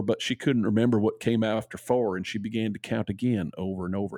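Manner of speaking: normal talking voice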